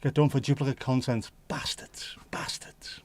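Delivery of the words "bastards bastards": hushed whispered swearing; whispered curse. Real, not acted. Muttering a curse at the platform under his breath. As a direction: hiss it under your breath.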